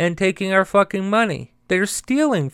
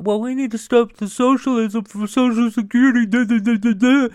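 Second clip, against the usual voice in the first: mocking the other side; 7 semitones higher than usual. Ranting vlogger mimics people who want to end Social Security, mock high voice. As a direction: sneer out their talking point in a silly voice. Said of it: "so everybody thinks that".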